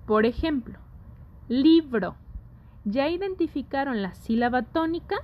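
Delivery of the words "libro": "libro" with LI higher and louder, then falling; stressed tonic syllable. Shown as a demonstration. Teacher gives students an example word, stressing LI in "libro". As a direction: punch the first syllable of "libro". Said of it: "la que suena más fuerte".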